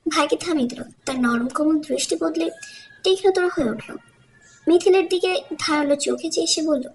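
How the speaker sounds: ordinary delivery, nothing marked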